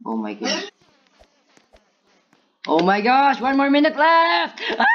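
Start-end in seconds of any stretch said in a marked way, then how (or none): 2.7-4.9 high, loud yell rising into a scream; panicked shout. Real, not acted. Player with one minute left, panicked shout. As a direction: shriek in alarm at the clock.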